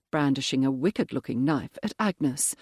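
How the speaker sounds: ordinary delivery, nothing marked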